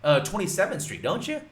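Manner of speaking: bright, loud, upbeat greeting; cheery caller. Shown as a demonstration. Coach demos a cheery call to homeowner James, upbeat and loud. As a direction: greet him like an old friend, fast and lively. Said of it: "Throw in some laughs in there".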